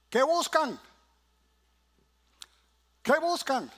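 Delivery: high, loud searching question; voicing Jesus. Jesus asks the following disciples what they seek. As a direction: call out as Jesus turning around.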